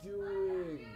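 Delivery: chanted protest, each DOING stretched out; hammed-up panic. Player trapped watching his wife attacked, hammed-up panic. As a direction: repeat it in mock despair, surging on the last word.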